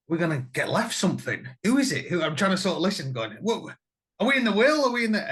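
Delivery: high and loud, hamming up greedy excitement; mock eager. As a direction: pitch it up like a kid hoping for treasure. Retold greedy thought about will.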